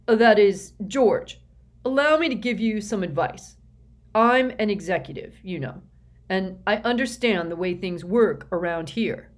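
loud, self-important official; pompous executive voice. A narrator's reading. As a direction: lecture the kid with puffed-up importance. S Q character voice.

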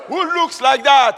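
loud call to the congregation; preacher's shout. Real, not acted. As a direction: shout the question out. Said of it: "Say it again".

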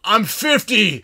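loud, drawn-out mock complaint; exasperated retold yell. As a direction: holler it slowly, hamming up the exhaustion. Downed older player's retold complaint.

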